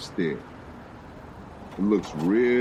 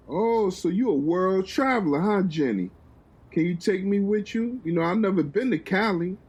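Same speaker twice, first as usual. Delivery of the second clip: flirty Uncle; 9 semitones higher than usual. Acted.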